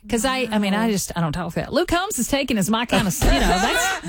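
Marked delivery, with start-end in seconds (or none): 1.7-3.1 country Southern accent, with amusement; natural Southern twang. Shown as a demonstration. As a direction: read it in an easy Southern twang. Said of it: "that's how I talk".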